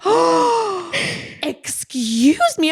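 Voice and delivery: high, slow indignant gasp; mock outraged excuse me; offended. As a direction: act deeply insulted.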